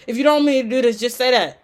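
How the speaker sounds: mock exasperated prayer shouted upward; pleading to the sky. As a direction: cry out the request to heaven, half joking. Praying to the Lord, louder.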